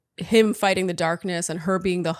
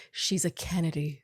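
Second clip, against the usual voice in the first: much softer, lower aside; hushed awe; 4 semitones lower, 8 dB quieter than usual. Real, not acted. Host marvelling at the famous family, hushed awe. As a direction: say it softly, impressed by the name. Awed repeat of the famous name.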